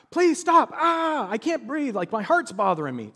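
mock a zapped victim crying out; imitated screaming plea. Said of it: "The students were screaming".

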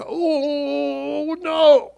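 sudden high panicked "oh no", then dropping; panicked cry. Retold or imitated. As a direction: brothers panicking, sure they will die. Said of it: "They're like".

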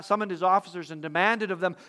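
usual speaking voice

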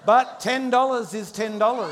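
loud, high punchline over the laughter; punchline shout. Real, not acted. Pastor shouts the punchline over the congregation's laughter, loud and high. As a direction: belt the punchline as the crowd laughs.

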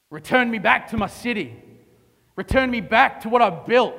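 usual speaking voice

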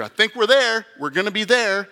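high, loud eager hope; the angel can't contain himself; excited angel. Acted.

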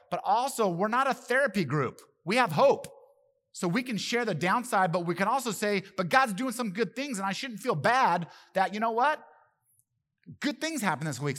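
loud, high preaching; fervent preaching. Real, not acted. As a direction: preach it with full passion. Preaching with rising passion.

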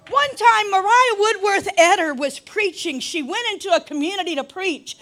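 impassioned preacher, big stretched emphatic words; full-force preaching. Real, not acted. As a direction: drive it home to the congregation.

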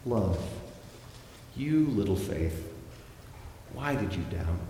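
soft, gentle reproach; quiet, loving question. Shown as a demonstration. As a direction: say it tenderly, letting the voice sink at the end. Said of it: "is on the last one, on love".

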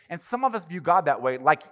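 ordinary talk, nothing marked

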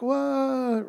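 high, startled what; a jolted, high-pitched what from Laban; surprised what. Retold or imitated. Laban greets his nephew with a high, surprised what. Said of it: "he's like".

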